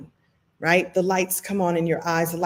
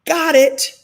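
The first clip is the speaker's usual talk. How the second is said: high, loud sudden realization; acted aha moment; 8 semitones higher, 7 dB louder than usual. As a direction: exclaim as the idea lands. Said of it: "witnessed your own lights come on".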